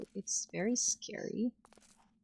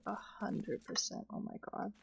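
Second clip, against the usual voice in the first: whispered aside; 7 dB quieter than usual. Real, not acted. Hushed reaction while mining.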